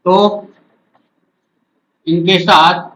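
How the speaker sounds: slow chunks with long pauses; dictating while writing. Real, not acted. Teacher writes out the rule for students, slow dictation. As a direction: dictate phrase by phrase, waiting after each.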